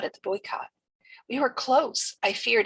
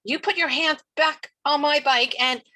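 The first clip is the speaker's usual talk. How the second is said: raised, high, loud warning; angry threat; 7 semitones higher, 6 dB louder than usual. A narrator's reading. Girl warning the bike thief, angry raised voice. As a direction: threaten loudly, breaking off at the end.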